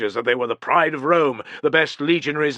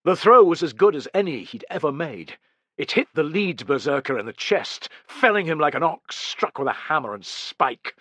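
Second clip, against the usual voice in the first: ordinary delivery, nothing marked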